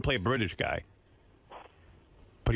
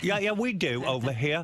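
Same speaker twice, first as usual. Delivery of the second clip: slow, higher put-on British voice; British accent; 4 semitones higher than usual. Host arguing as a Brit, playful put-on British accent. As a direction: answer back as a hammy Brit, laughingly. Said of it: "I go".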